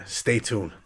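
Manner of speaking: usual speaking voice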